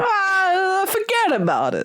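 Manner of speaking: high, drawn-out New York mobster "Ah, forget about it"; mobster accent. Retold or imitated. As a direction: toss it off like a wiseguy from New York. Mobster catchphrase impression.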